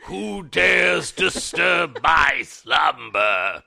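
slow booming ancient monster voice; grinding stone statue. Pretend. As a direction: boom it out like a woken stone giant. Mock ancient being woken.

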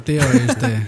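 put-on Castilian accent; mock Spain accent. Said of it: "Eso es muy español".

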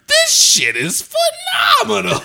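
very high, loud, slow, laughing shout. Retold or imitated. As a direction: scream your delight while laughing. Retold ecstatic reaction.

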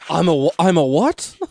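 put-on startled boy, high and loud; shocked kid voice. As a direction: gasp it out like a stunned kid. Harry voiced in parody bit.

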